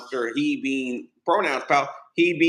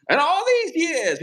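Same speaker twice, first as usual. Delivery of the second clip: slow, much higher, grandly intoned; hamming up the film's opening line; mock-solemn narrator voice; dramatic movie narration; 14 semitones higher, 4 dB louder, slower than usual. Quoting film narrator dramatically.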